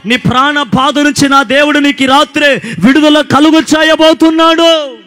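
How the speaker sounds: loud, high, fervent preaching to the crowd; full-force preaching. Acted. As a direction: preach it out, building and calling hallelujah. (బిగ్గరగా, ఉత్సాహంగా ప్రసంగం)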